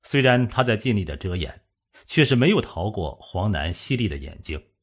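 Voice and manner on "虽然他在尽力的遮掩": ordinary delivery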